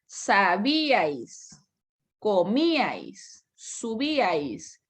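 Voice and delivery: each word drawn out slowly, syllable by syllable, "Sabíais" stretched with a held final s; slow teacher's pronunciation model; slow syllable demo. Shown as a demonstration. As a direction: spell out "comíais" slowly for the class. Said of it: "Vean lo que pasa aquí".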